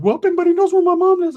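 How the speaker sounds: high squeaky falsetto; hammed-up squeaky sidekick; Ricky's voice. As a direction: pitch way up into a goofy falsetto. Ricky voiced in retold story.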